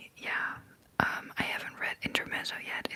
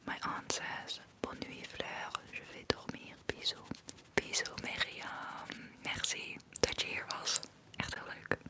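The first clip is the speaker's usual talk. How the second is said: ASMR whisper. Real, not acted. Streamer answering chat comments to viewers, in an ASMR whisper.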